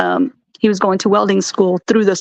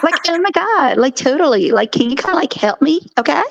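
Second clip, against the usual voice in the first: put-on ditzy Valley Girl asking for help; 7 semitones higher than usual. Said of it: "put on my Valley Girl voice".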